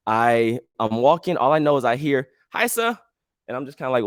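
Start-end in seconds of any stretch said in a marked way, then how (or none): 2.5-3.0 high-pitched mimicked greeting; voiced little guy. Little Indian guy greeting a stranger, mimicked high voice. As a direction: imitate a small man piping up.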